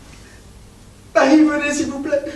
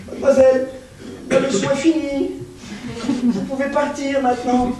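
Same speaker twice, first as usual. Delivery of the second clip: ordinary talk, nothing marked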